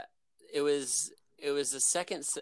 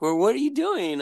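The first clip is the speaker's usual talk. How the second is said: high, louder mock mom question; mom asking; 12 semitones higher, 8 dB louder than usual. Mom asking her son on the phone, high and loud. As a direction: brighten up as the curious mom.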